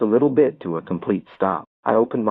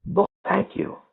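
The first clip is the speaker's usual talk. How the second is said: high-pitched nervous thank-you; small, high, anxious voice; 11 semitones higher than usual. A narrator's reading. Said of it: "nervously say".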